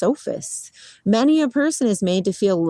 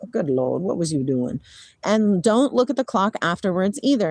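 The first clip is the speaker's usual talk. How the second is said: ordinary talk, nothing marked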